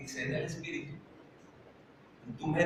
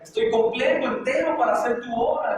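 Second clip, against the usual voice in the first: raised, louder preaching voice; preaching at full force; 6 semitones higher, 3 dB louder than usual. Acted. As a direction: urge the congregation on, fervent and insistent. Preacher exhorts to pray always.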